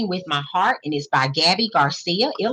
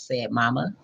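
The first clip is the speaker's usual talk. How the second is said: plain reading voice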